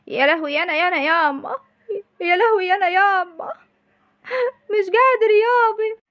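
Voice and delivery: high crying wail, slowing at the end; wailing sob. Acted. Mother wailing over her beaten son, sobbing.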